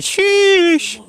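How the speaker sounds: plain reading voice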